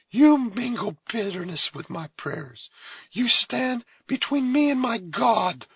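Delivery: high, loud, slow and grave; solemn reproach. A narrator's reading. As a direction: rebuke him as in prayer. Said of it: "with a solemnity".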